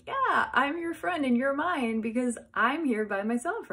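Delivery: high, loud, eager reply; gleeful agreement. Retold or imitated. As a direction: agree with bubbling excitement. Excitedly agrees with friend.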